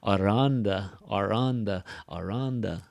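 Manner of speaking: "Aranda" said as one word, stress on middle "ran"; blended "Aranda". Shown as a demonstration. Teacher demonstrating linking, "Aranda" as one word. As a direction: run "are on the" together as "Aranda". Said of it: "Get that rhythm".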